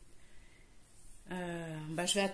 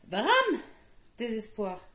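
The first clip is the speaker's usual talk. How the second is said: mock drama; slower than usual. Knitter mock-mourning a dropped stitch to viewers. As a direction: play it like a mock disaster.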